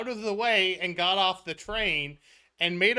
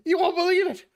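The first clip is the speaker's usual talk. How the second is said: higher, louder frantic retelling; George panicking; 7 semitones higher, 4 dB louder than usual. Retold or imitated. George telling Jerry he fled the train, higher and louder. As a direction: gush the disaster out in a rush. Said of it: "and George goes".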